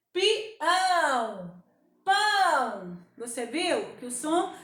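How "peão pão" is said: drawn-out nasal words through a closed nose; pinched-nose demo. Shown as a demonstration. Teacher showing how closing the nose changes sound, pinched nasal voice. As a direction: hold your nose and sound out each word. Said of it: "Quando você fecha o nariz, olha só".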